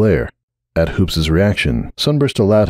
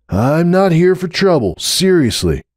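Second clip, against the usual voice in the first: defensive; 10 semitones higher than usual. Acted. Hoops defensive.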